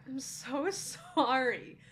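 stretched-out, very slow sorry; slow mock apology. Telling the cohost her reaction, slow mock apology. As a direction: drag out the apology while still laughing. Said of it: "I was like".

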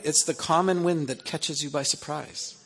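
ordinary talk

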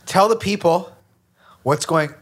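loud, half-shouted; talking way too loud. Real, not acted. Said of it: "I'm screaming a bit".

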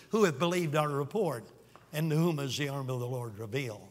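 measured, solemn Bible reading; scripture reading. Acted. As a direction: read the prophecy with reverence. Reads Isaiah 53 aloud.

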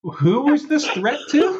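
high, slow, laughing question; laughing disbelief. Real, not acted. Host asks co-host, laughing in disbelief. Reacting to threatening message.

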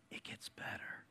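breathy stage whisper, almost no voice; Joe Biden whisper. Acted. Pastor teasing the congregation, whispering like Joe Biden. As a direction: lean in and whisper it like a secret. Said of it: "Oh, I just pulled a Joe Biden".

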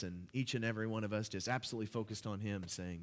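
normal talking voice

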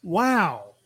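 slow, drawn-out surprised wow; Owen Wilson voice. Real, not acted. Host slipping into an Owen Wilson impression without meaning to. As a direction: lean into the nasal laid-back drawl. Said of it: "I'm suddenly Owen Wilson".

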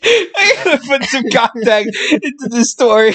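high, laughter-squeezed voice; laughing hard. Real, not acted. Podcaster mocking own rambling story, laughing hard. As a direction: push the words out through laughter.

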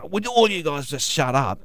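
a mock snappy demand to rowdy passengers; mock rude demand. Driver snapping at rowdy passengers, mock rude demand. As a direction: bark it like a fed-up cabbie. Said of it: "don't go".

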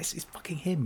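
awed whisper-like disbelief. Retold or imitated. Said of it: "And you're like".

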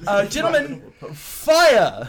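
high, loud, posh-officer command; pompous captain. Acted. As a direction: bellow the order like a pompous captain. Captain orders cannons fired.

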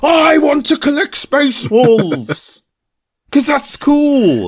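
high, slow, gushing fanboy voice. Retold or imitated. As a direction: swoon like an eager fan. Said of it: "I was like".

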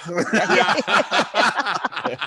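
high, loud mock shout through laughs; annoyed daughter yelling. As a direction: yell it like a fed-up kid.

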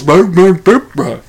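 loud nonsense brr sounds standing in for a name; garbled mumble noise. Retold or imitated. Mimicking a podcast guest who garbles the movie title. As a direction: babble it like an unclear word. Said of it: "it goes".

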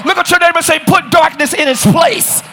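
loud, high sermon exhortation; full-force preaching. Real, not acted. Preacher telling congregation what to repeat, full-force preaching. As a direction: shout it out for the whole church.

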